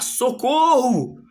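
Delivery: high, loud, drawn-out mock scream; acted cry for help. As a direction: stretch out a panicked crowd's shout. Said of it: "começam a gritar".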